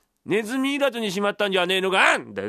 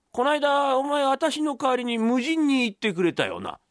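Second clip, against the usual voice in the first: higher voice calling upstairs; father remembering something, raised pitch; father of the story, inquiring; 6 semitones higher, slower than usual. Acted. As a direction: call up with a sudden question.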